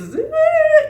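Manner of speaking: loud, very high mock wail; bratty kid wail. Mocking the movie's bratty little boy, loud high whiny wail. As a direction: imitate a brat bawling at full pitch. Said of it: "these little bratty little kids".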